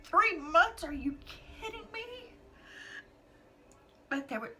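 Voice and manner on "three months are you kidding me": high, breaking cry of disbelief. Real, not acted. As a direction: cry out in tearful shock. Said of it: "I was like".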